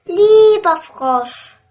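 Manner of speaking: high, loud, sing-song offer; the princess gushing to get her ball back; eager promise. A narrator's reading. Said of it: "sagt die Königstochter".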